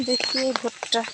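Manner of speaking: ordinary delivery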